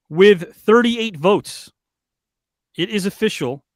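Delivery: slow, loud result announcement; announcer voice. Acted. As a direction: draw it out like a ring announcer.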